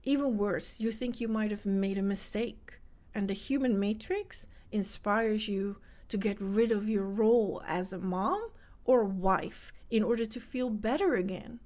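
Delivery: ordinary talk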